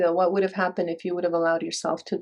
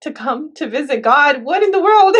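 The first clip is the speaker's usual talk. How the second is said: mock-indignant, pitched high and climbing; high-pitched, exasperated complaint, playfully imitated; annoyed; 7 semitones higher than usual. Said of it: "like, so annoyed".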